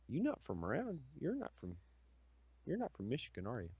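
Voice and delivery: struggling to suppress a Southern twang; soft, careful, de-twanged Southern speech; twang held back. Retold or imitated. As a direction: say it straining to hide the Southern accent. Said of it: "hear the struggle in his voice to say that without fucking twang".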